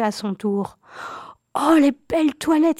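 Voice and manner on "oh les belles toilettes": high, loud, thrilled cry of wonder; delighted exclamation. A narrator's reading. Cousin peeking over the wall at the ball, crying out thrilled. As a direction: gasp it out in pure delight at the gowns. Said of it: "s'écria-t-elle".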